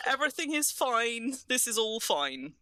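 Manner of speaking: high-pitched, insisting all is well; comically overdone reassurance after a mishap; flustered self-reassurance. Real, not acted. Player who dropped a roasting dish, flustered and insisting.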